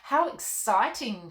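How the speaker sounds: put-on a child thrilled by the news; slow, loud, gasping delight; gasping excitement. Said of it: "Molly gasped and clapped her hands".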